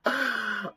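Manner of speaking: helpless high laugh breaking into a cry-like squeal; real laughter. Real, not acted. Creator laughing at their own elevator story, high squealing laugh. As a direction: laugh until it almost becomes crying. Cracking up at the memory.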